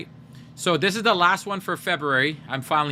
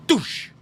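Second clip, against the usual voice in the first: sudden explosive "doosh" crash noise; imitated big thud; 7 semitones higher than usual. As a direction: mimic something slamming into a wall. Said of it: "like a big thud sound".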